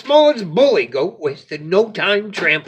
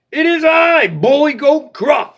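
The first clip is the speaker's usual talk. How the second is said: boastful announcing; 10 semitones higher, 6 dB louder than usual. Acted. Bully goat announces himself.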